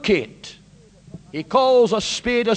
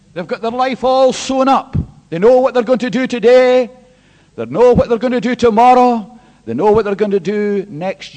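loud, higher, driving sermon voice; full-force preaching; 5 semitones higher, 10 dB louder than usual. Real, not acted. Preacher confronting the congregation with verse thirteen, loud. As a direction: hammer the scripture home.

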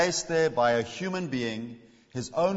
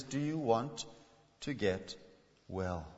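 tender; 4 semitones lower, 9 dB quieter, slower than usual. Acted. Tender question to listener.

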